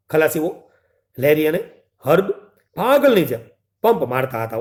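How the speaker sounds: ordinary delivery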